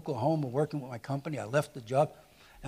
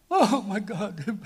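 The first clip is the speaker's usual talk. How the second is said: laughing; 5 semitones higher, much slower than usual. Real, not acted. Recalling reading Judges.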